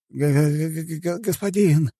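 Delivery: high, stammering assistant; frightened stutter. A narrator's reading. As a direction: stumble repeatedly on the first sound, trembling. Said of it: "затрясся всем телом помощник". (испуганно заикается)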